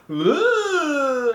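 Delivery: high, drawn-out dopey noise; mock clueless groan. Podcaster mimicking a dim movie character's reaction, mock clueless groan. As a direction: imitate a dimwit with a high, held "ugh".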